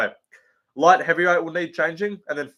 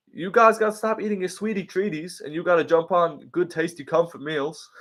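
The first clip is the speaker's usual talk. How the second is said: higher, mock American-accent pitch; lightly done American accent, higher; higher Lucas Tracy voice; 3 semitones higher than usual. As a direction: push the voice up like a cheesy YouTuber ad. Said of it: "I can't go high pitched like Lucas".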